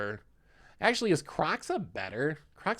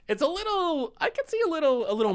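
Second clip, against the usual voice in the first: high squeaky voice; 4 dB louder, faster than usual. Pretend. Excited musing voice high.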